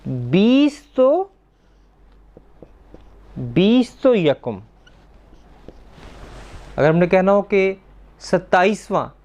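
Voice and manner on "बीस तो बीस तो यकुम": very slow, drawn-out बीस तो यकुम; teacher building the number slowly; slow demonstration. Shown as a demonstration. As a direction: spell it out word by word. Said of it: "तो हम क्या करेंगे?".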